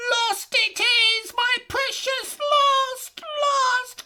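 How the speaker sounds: high, loud shrieking creature; Gollum wailing. A narrator's reading. Gollum discovering his ring lost, shrieking. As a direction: shriek out the loss in despair.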